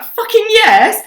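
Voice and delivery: high, loud triumphant yes; thrilled. Retold or imitated. Thrilled that a witness heard it too, loud and high. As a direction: celebrate like you won.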